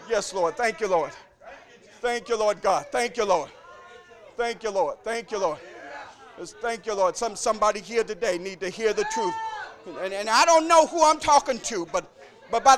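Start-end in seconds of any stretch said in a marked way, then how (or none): 0.1-7.2 quiet prayer. Real, not acted. Quiet prayerful murmur after climax.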